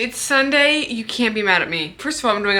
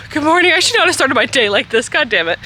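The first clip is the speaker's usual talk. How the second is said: flustered greeting; 4 semitones higher, 3 dB louder than usual. Real, not acted. Greets someone passing, flustered.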